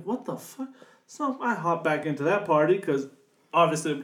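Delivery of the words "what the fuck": shocked. Retold or imitated. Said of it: "I was like".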